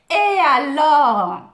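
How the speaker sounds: loud, drawn-out so-what; big, stretched-out dismissive challenge; defiant retort. Acted. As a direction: throw it back slowly and loudly. Defiant catchphrase answering a viewer comment.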